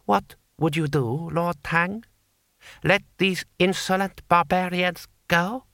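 high, fast, lofty voice; haughty lord. Acted. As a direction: rattle off superior certainty. Lord Hong challenging.

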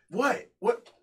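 high-pitched imitation of clueless questioning; retold innocent surprise; mock-innocent girl. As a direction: play her baffled, voice jumping up. Acts out girl's innocent reaction.

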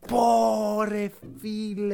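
drawn-out, impressed exclamation; retold amazement. Fan recalling how they reacted to the Hornets, drawn-out amazement. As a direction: stretch it out in recalled awe.

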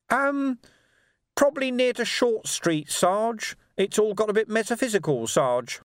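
very high, hedging little voice; evasive urchin. A narrator's reading. Urchin reporting where the barricades went, evasive. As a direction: hedge in a high pipe.

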